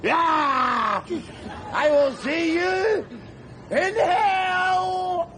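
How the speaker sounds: defiant yell. Acted. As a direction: fling it at him across the water. Drunk yelling after a jumper.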